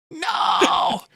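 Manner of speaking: high, drawn-out cry of No; mock scream. Podcaster acting out the dented victim, mock scream. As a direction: cry out in hammed-up horror.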